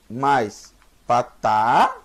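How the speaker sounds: patá stretched very slowly; drawn-out teaching. Shown as a demonstration. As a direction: draw it out for the pupil. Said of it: "teacher drawing out vowel sign".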